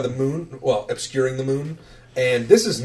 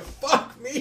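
high, softer strained complaint; exasperated groan in character; 13 semitones higher, 6 dB quieter than usual. Acted. Rower who rolled like shit, high quiet complaint. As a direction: moan it high and small. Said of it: "and he's just going".

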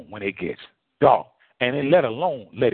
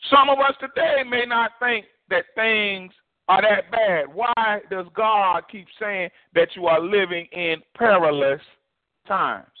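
higher, driving sermon cadence; full-force preaching; 12 semitones higher than usual. Acted. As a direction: preach it up to the congregation.

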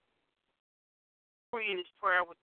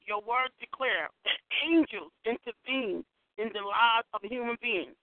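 praying; 3 semitones higher, 4 dB louder than usual. Acted. Leads a decree prayer.

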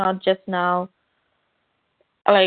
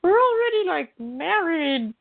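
mock incredulous; 8 semitones higher than usual. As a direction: mimic her like it's obvious. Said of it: "and Mira's like".

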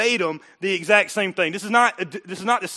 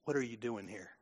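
hushed voice of God; 9 semitones lower, 16 dB quieter than usual. Acted. God's voice in the cave.

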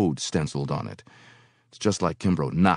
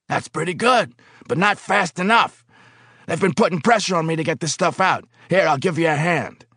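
higher, louder harried foreman; pressured boss; 12 semitones higher, 5 dB louder than usual. Acted. Foreman under pressure offering the worker help. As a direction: sound pushed by his bosses. Kimbrough voiced.

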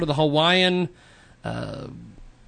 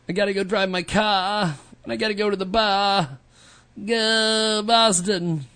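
put-on Boston accent; 3 semitones higher than usual. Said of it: "Why am I making fun of Boston people today?".

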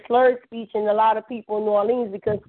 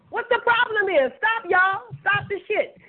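exasperated; 8 semitones higher than usual. Real, not acted. Exasperated appeal to listeners.

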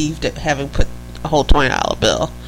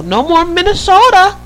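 put-on Minnesota accent; 11 semitones higher, 5 dB louder than usual. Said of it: "let me know how my Minnesota accent is".

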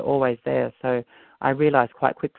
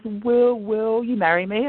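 mock proposal; 8 semitones higher, slower than usual. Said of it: "the guy actually jokes around with me".

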